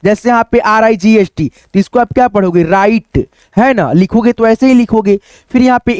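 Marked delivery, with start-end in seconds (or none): none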